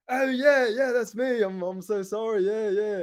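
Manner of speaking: high, hurried Australian cover-up; sudden Australian accent. Retold or imitated. Salesman caught out by the dad, flustered Australian accent. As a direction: scramble back into a pretend Australian. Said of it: "the Australian guy".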